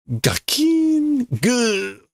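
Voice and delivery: high sharp clang then a pained grunt; clonk and grunt. Acted. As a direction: hit sound, then a gasp. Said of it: "gets clonked in the head".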